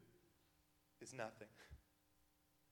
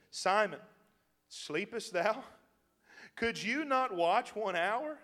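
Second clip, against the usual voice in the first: stern rebuke; 4 semitones higher, 5 dB louder, slower than usual. Acted. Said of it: "That's how we read it".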